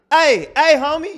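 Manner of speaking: loud high-pitched mock street holler; imitated far-off shout for attention; distant yell. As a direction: call out repeatedly from down the block. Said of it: "you just hear it off in the distance".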